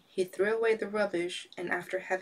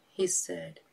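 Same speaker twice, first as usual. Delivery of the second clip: ordinary delivery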